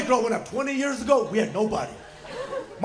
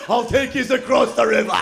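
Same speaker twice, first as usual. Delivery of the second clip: hammed-up British troll accent; 5 dB louder than usual. Lantern character offering passage, exaggerated British troll accent. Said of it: "like, troll accent".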